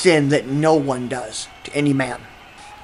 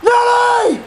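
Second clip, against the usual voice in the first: put-on drunk yelling outside. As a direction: holler names across the lot. Calling names out loud.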